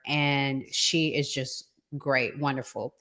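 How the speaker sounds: ordinary talk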